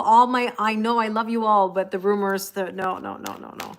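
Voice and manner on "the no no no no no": normal talking voice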